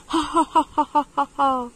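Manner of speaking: loud staccato villain laughter; hammed-up bandit cackle; evil laugh. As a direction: laugh triumphantly, each ha punched out. Robber laughs at his prey.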